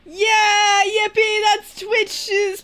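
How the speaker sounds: high, loud yippee; excited cheer. Real, not acted. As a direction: squeal with joy. Excited cheer over rat pics.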